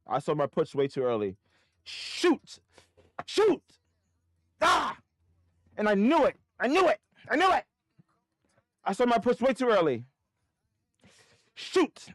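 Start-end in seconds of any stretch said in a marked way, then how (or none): 1.8-7.6 high, louder exasperated cries; real frustrated outburst. Real, not acted. Realises he sold too early. 8.8-10.0 frustrated cry. Real, not acted. Same line said plainly before. 11.5-12.1 real frustrated outburst. Real, not acted. Frustration after mistake.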